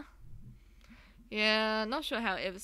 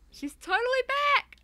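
much higher and louder, gleeful; excited character yell; 7 dB louder than usual. Acted. Player voicing Greg announcing Mae's return, excited yell. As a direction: cheer like an overjoyed friend.

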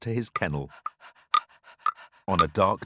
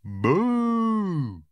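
spooky boo; 8 semitones higher than usual. Acted. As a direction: jump out with a playful boo. Pets chasing the dog.

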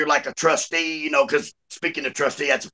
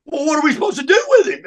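high, louder mock exasperation; exasperated jail lady; 12 semitones higher, 5 dB louder than usual. Jail lady asking guard about quitter, exasperated. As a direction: retell her at a loss, voice pushed up.

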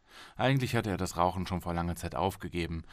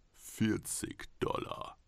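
whispered, slow price offer; hushed bid; 3 semitones higher, 5 dB quieter, much slower than usual. Acted. Golem's buyer whispering his falling bid. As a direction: breathe the number out slowly. Golem's man bids quietly.

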